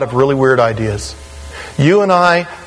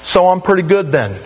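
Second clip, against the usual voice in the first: higher-pitched mock excuse-making; self-satisfied churchgoer; 7 semitones higher than usual. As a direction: imitate a listener rationalizing. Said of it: "Now, some of you say".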